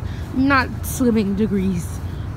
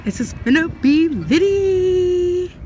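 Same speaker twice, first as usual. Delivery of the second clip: excited; 6 semitones higher, slower than usual. Real, not acted. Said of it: "I'm so excited".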